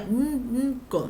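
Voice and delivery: mm, mm, co- as a stuck, hummed attempt; hummed mm, mm, then a cut-off co-; word without its vowel. Shown as a demonstration. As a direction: try blanco with no A, humming mm before co-. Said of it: "Si le quitáramos esta A".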